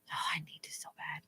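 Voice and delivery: soft unvoiced whisper. Real, not acted. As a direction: whisper it guiltily. Guilty admission, whispered.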